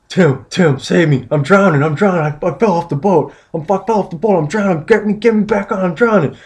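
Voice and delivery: high, panicked cries for help; mock panicked pleading. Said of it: "I was listening to him repeat".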